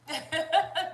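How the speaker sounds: loud, high burst of laughter. Real, not acted. Reader losing it live on stream, loud laugh. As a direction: crack up. Spirit guides cutting up.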